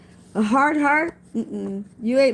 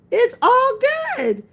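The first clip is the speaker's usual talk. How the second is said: much higher, ringing out "It's all good"; rising joyful emphasis; 11 semitones higher, 4 dB louder, slower than usual. Shown as a demonstration. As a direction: lift "all" high and joyful like a preacher. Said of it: "I always say".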